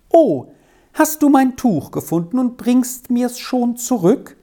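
put-on high, delighted girl calling out; bright "oh", then a high, eager question; pleased exclamation. A narrator's reading. Girl greets boy who found her shawl, pleased exclamation. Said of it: "rief sie befriedigt aus".

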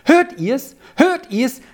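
put-on high, eager boyish call; joyful calling out. A narrator's reading. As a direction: call out in delight, pitch leaping up. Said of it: "rief der Sami in seiner Freude aus". (freudig und hoch gerufen)

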